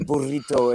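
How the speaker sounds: burrito with over-rolled Spanish R; over-enunciate burrito in mock Spanish; exaggerated Spanish pronunciation. Mimicking Americans ordering Mexican food, exaggerated Spanish burrito. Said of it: "a lot of Americans really exaggerate that".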